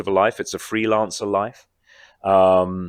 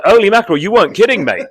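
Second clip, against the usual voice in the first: much higher, louder exclamation of disbelief; astonished; 11 semitones higher, 9 dB louder, faster than usual. Retold or imitated. Actor recalling his shock at the co-star's transformation, loud astonished outburst. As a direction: blurt it out, stunned.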